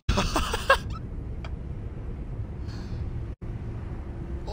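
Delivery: high breathy laughter; breathless laugh. Real, not acted. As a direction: laugh out the shock. Breathless laugh after scare.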